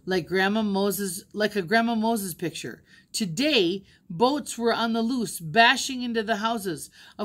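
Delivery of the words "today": ordinary delivery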